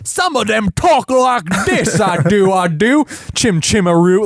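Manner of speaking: high, hammed-up Cockney; Cockney accent. Shown as a demonstration. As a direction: do an exaggerated Mary Poppins Cockney. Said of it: "they could be talking Cockney too".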